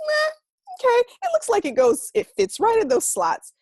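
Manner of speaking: put-on high, loud, shrugging kid voice; careless toddler. A one-year-old stuffing bills into a vent, high and carefree.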